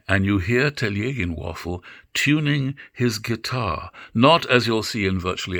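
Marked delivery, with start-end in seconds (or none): none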